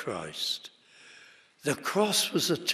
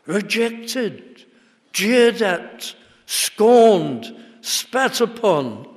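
louder and higher, each phrase hammered home; fervent preaching; 4 semitones higher, 6 dB louder than usual. Real, not acted. Preacher to congregation about the shameful death, full-force preaching.

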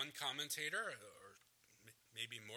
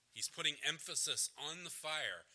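ordinary talk

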